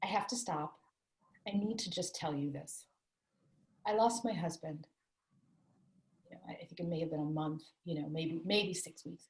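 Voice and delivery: retelling softly what she told the masseuse; soft retold confession of grief; quiet, fragile. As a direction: hush it, close to tears but holding. Retold quiet plea to her masseuse.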